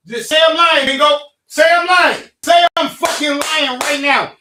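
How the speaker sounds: yelling a furious challenge at his friend; shouting across the podcast table, daring Mingo to call him a liar; angry shouting. Real, not acted. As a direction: bellow it, daring him to deny it.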